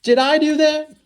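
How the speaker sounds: high, loud nerdy sitcom catchphrase; nasal-free but high, loud goofy sitcom kid voice; Urkel. Acted. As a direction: squeak it out like the TV nerd. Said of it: "Not to be confused with Urkel".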